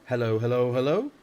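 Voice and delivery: loud parrot call, lifting at the end. A narrator's reading. Hidden parrot calls to the tense listening family, loud mimicry. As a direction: squawk a greeting from hiding. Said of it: "came the voice again".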